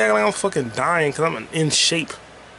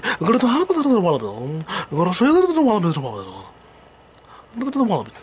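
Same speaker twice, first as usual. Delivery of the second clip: fast, higher rapper voice; lightly voiced Bay Area rapper; [name] impression; 4 semitones higher, much faster than usual. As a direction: rattle it off fast like [name]. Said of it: "E forty talk and rap like he always says".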